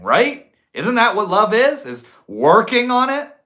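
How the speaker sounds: high, loud pleading send-up; hammed-up panic begging for agreement; mock desperate questioning. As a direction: climb into frantic, insistent questions. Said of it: "it's like you're like".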